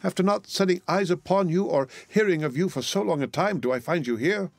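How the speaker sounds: high-pitched, emotional outpouring; acted astonishment, pitched well up; overcome sister. A narrator's reading. Sister overcome at finding lost brother.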